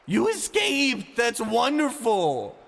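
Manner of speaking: high, loud, slow pained shriek; a beaten man's shriek. Acted. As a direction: shriek it while writhing. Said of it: "Ivan shrieked as he writhed".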